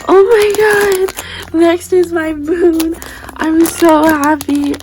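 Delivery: drawn-out joy at a long-wanted prize; thrilled. Real, not acted. Showing viewers the moon squishy she wanted, real thrilled gush.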